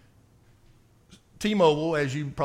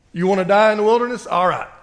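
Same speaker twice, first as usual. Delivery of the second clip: loud, fast, higher challenge; forceful preaching; 4 semitones higher, 8 dB louder, faster than usual. Real, not acted. Preacher confronting grumblers in the church, loud and fast. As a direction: throw the question hard at them.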